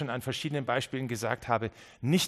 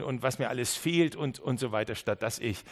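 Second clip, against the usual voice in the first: usual speaking voice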